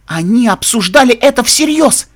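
high, loud alarm; horrified realisation. A narrator's reading. Captive realising the guards mean it seriously, loud alarm. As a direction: let the horror burst out.

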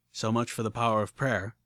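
plain reading voice

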